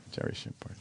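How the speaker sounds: breathy hushed whisper. Real, not acted. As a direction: breathe the name out softly. Echoing the dreaded name.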